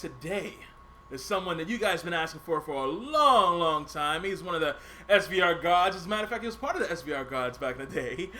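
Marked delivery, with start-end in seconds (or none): none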